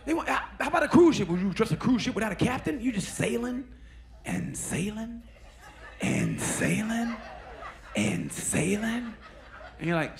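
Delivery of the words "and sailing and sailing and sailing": stretched out. Pretend. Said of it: "drawn-out repetition".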